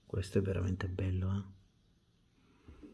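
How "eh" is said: soft hushed aside; whispering. Real, not acted. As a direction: whisper it to the camera.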